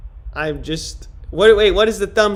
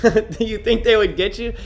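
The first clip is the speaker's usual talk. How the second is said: ordinary talk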